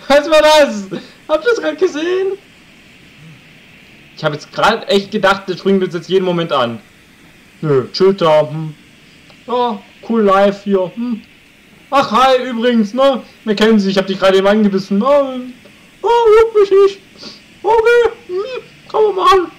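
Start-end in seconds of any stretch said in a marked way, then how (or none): 0.1-2.4 startled laughing disbelief. Real, not acted. Streamer asks viewers if they saw that, laughing high shock. 7.6-19.5 much higher, louder cartoon wolf voice; voicing the wolf. Streamer gives the biting wolf a high smug voice.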